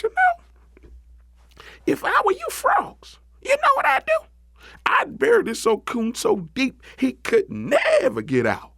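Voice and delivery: very high rabbit voice; high, animated trickster rabbit; scheming Brer Rabbit. Acted. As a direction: pitch your scheme to the frogs, way up high.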